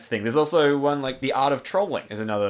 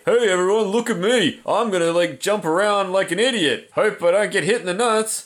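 goofy guy voice; 6 semitones higher, 3 dB louder than usual. Retold or imitated. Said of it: "what would that guy say".

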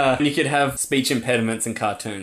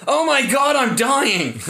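hammed-up terrified yell, much higher and louder; mock panic; 11 semitones higher, 4 dB louder, slower than usual. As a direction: fake a screaming panic as the bear strikes. Imagined polar bear attack.

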